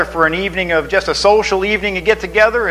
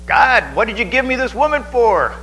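high, aggrieved whine at God; complaining Adam; 7 semitones higher than usual. Retold or imitated. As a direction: gripe like a wronged husband. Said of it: "who was the first complainer? Adam".

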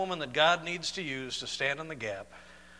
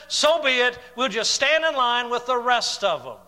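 loud, high-pitched preacher in full flow; full-force preaching; 9 semitones higher, 11 dB louder than usual. Real, not acted. Preacher rallying Christians facing persecution, full-force preaching.

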